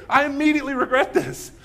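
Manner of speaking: high, loud comic outburst; Ron Burgundy. Retold or imitated. Anchorman owning up to his mistake, loud mock regret. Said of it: "even Ron Burgundy will own up".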